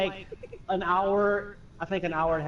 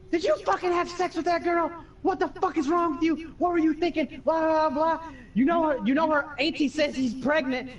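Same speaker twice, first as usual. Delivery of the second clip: angry mom yelling; 10 semitones higher than usual. Retold or imitated. Mom scolding her son over the phone, high and furious. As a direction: tear into him like an outraged mom.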